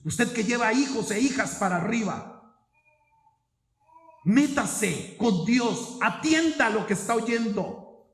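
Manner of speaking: loud, high preaching with slowed key phrases; fervent exhortation. Acted. Pastor urging parents to commit to God, full preaching force. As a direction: exhort them from the pulpit.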